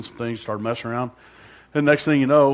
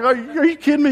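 stunned disbelief; 6 dB louder than usual. Retold or imitated. Stunned reply to the pastor.

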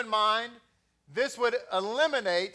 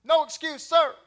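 higher, louder recruit sounding off; 7 semitones higher, 5 dB louder than usual. Retold or imitated. Recruit answering the drill instructor, loud and crisp. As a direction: snap to attention and sound off. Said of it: "If you said".

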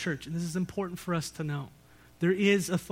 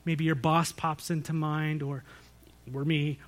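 ordinary talk, nothing marked

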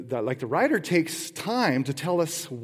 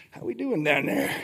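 talking to tomatoes. Retold or imitated. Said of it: "it's like".

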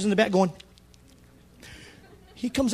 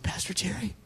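whispered, voice barely there; tearful whisper; 2 semitones higher, 11 dB quieter than usual. Retold or imitated. John answering the pastor through tears.

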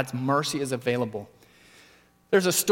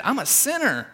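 much higher, pleading cry of confession; tax collector's confession; 10 semitones higher than usual. Retold or imitated. Tax collector confessing to God, high pleading cry. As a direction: cry it out as a broken man begging. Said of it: "He said".

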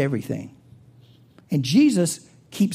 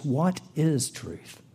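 What is truth? drawn out slowly and softly; slow quoted question; 4 semitones lower, 7 dB quieter, much slower than usual. Shown as a demonstration. Preacher giving the fill-in answer slowly to the congregation. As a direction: linger on each word of What is truth?. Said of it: "your second fill-in is".

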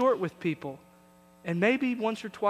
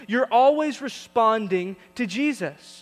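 slow, louder emphatic preaching; 4 semitones higher, 6 dB louder than usual. Acted. Pastor urging congregation to respond to Jesus, emphatic preaching. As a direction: drive the point home to the church.

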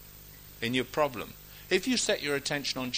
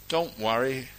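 ordinary talk, nothing marked

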